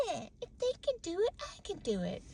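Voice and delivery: grumbling stubborn mumble; sulky half-muttered defiance; obstinate mutter. Retold or imitated. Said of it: "getting obstinate and going".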